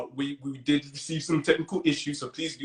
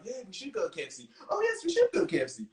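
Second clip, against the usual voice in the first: soft, higher mimicked classmates; put-on, pitched-up voices of agreeing kids; 8 semitones higher, 4 dB quieter than usual. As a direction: chime in lightly as different schoolmates. Acting circle of classmates agreeing.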